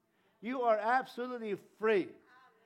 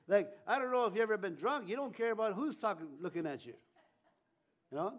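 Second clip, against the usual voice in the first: ordinary talk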